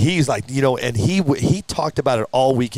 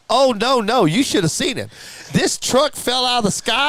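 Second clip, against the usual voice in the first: high, animated mimicked accent of an eyewitness; Keith West's accent; 11 semitones higher than usual. Witness describing the truck's fall, in Keith's accent. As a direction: put on his accent, pitched way up. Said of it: "he's got that accent".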